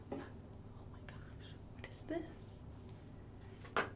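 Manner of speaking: slow awed whisper. Acted. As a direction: breathe it out in wonder. Awed discovery for kids.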